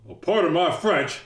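high, loud put-on grown-up voice; hammed-up, indignant phone impersonation; Cameron's phone voice. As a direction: do Cameron posing as an angry father. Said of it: "when he does the voice".

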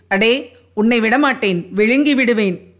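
ordinary delivery

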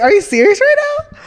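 high, loud disbelieving shriek; shocked yell. Retold or imitated. Retelling finding the ex's texts, shrieking in high loud disbelief. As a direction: yell it, floored she'd do this. Retold shock at friend's betrayal.

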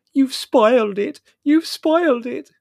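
very high, loud, slow wail; tearful wailing. A narrator's reading. Margot blaming Mother in their room, tearful wailing. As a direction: wail it in hammed-up floods of tears. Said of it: "Margot burst into floods of tears".

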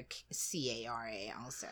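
spelled out in a whisper; whispered spelling. Real, not acted. Whispering the other spelling of Kara to the friend. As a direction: breathe the letters out, barely voiced.

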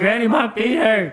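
put-on very high kid's whine; hammed-up pained complaint; pleading whine. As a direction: plead with granny in mock agony. Child pleading with granny.

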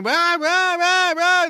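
high loud rahs, mock hen screeching; mock chicken screeching; chickens screaming. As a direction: screech it like a yard of frantic hens.

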